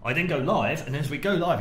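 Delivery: ordinary talk, nothing marked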